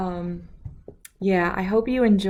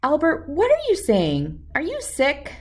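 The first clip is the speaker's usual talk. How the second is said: higher, anxious mom voice; worried mother; 10 semitones higher, 5 dB louder than usual. Acted. Worried mother questions silent child.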